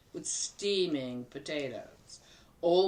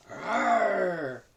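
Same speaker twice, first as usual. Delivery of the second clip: bear growl; 2 semitones higher, 4 dB louder than usual. Acted.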